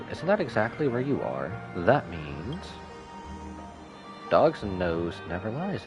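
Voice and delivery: ordinary talk, nothing marked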